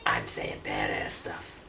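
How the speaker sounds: very low, slow action-hero voice; mock tough guy. Mimicking a cutscene hero, low slow tough-guy voice. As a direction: drop deep and slow for a badass one-liner. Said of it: "Drax taking up his glasses".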